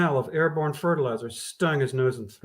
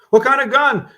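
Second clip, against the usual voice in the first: louder, raised question over the noise; asking over clamor; 10 semitones higher, 11 dB louder than usual. Acted. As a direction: cut through the shouting. Cop asks over the clamor.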